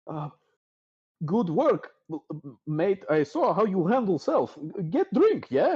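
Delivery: high, stammering, broken-English barkeep; nervous barkeep. Acted. Barkeep Durnan stalling the player at the bar, nervous and high.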